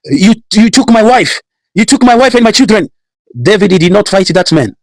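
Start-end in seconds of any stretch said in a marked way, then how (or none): 0.0-2.9 high-pitched accusing cry; angry accusation. Acted. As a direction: throw the blame at him, voice high and sharp. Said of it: "David is supposed to say".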